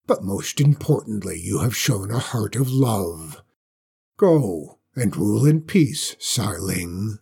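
low, solemn dragon verdict. Acted. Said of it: "Then it spoke".

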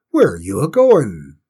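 loud cook's demand; blustering cook. A narrator's reading. Cook calls after departing Cinders, blustering. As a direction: call out sharply and loud.

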